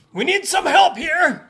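high, loud cry for help; shouted call. Acted. Merchant calling to his guards, shouting for help. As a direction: holler for backup across the battlefield. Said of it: "calls the merchant".

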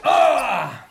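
loud, high "ah" yell; acted cry. Game master mid-combat, loud acted cry. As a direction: cry out suddenly at full voice.

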